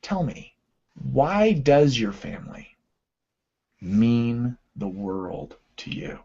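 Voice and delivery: slow, hushed, fading to a whisper; slow soft coaching question sinking into a whisper. Shown as a demonstration. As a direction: draw it out gently and quietly. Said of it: "exactly like they give it to you".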